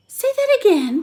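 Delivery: put-on boy bolting upright, excited; excited demand. A narrator's reading. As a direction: leap at the words eagerly. Stuart startled upright in bed.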